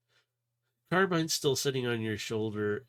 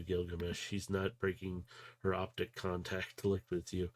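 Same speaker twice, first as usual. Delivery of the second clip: ordinary delivery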